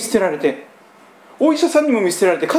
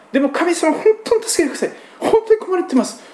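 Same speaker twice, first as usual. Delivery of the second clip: earnest plea; 10 semitones higher than usual. Retold or imitated. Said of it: "その真剣な祈り".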